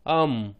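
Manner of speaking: अम as short 'uh' closing on m; blended syllable demo. Shown as a demonstration. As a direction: hum the m ending of अम. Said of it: "तो उच्चारण हुआ".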